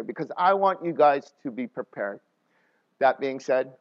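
ordinary talk, nothing marked

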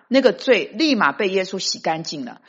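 ordinary talk, nothing marked

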